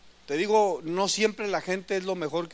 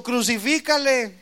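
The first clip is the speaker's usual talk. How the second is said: crowd shouting; 6 semitones higher, 6 dB louder than usual. Retold or imitated. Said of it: "estaban gritando".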